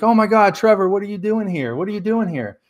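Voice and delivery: high, startled exclamation at seeing a friend; imitating her shock at spotting him here; shocked surprise.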